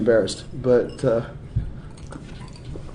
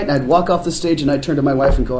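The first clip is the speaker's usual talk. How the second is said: ordinary talk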